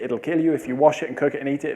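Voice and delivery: usual speaking voice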